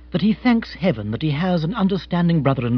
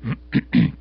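low throat-clearing; discreet cough. A narrator's reading. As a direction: clear the throat politely before speaking. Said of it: "Jeeves coughed".

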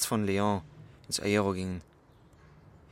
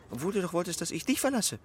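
reproach; 9 semitones higher than usual. Acted. Lover's reproachful accusation.

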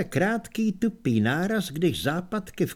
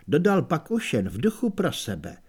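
usual speaking voice

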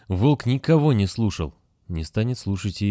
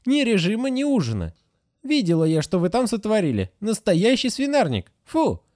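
much higher, indignant nurse voice; scolding nurse; 13 semitones higher than usual. A narrator's reading. Nurse Agatha scolding the children about their mess, high and indignant. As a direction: nag like a strict old nurse. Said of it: "scolding nurse Agatha".

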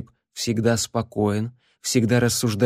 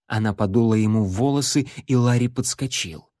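ordinary delivery, nothing marked

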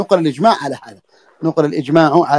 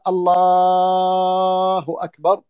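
very slow, drawn-out chanted call to prayer; muezzin's call; much slower than usual. Retold or imitated. Teacher imitating the muezzin's call for his students, slow drawn-out chant. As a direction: stretch it like the muezzin from the minaret. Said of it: "إذا قال".

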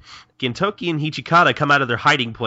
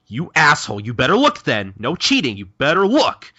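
raised, louder retort in a playful quarrel; mock angry warning; 5 semitones higher, 5 dB louder than usual. Gintoki warns his rival against cheating, mock angry. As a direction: scold a rival with mock outrage.

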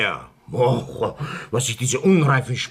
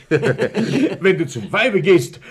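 laughing; 4 semitones higher, 5 dB louder, slower than usual. Acted. Husband quotes Nietzsche mockingly.

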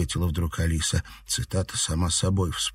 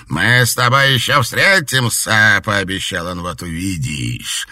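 menacing promise; 3 semitones higher, 7 dB louder than usual. A narrator's reading. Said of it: "Альбинос злобно расхохотался".

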